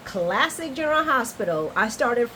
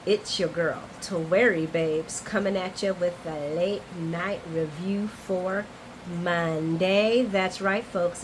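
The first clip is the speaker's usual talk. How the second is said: usual speaking voice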